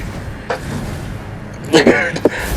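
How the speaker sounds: high scornful cackle; high mocking laugh. Real, not acted. Mocking the excuse.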